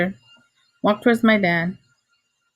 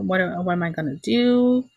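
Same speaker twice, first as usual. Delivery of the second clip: ordinary talk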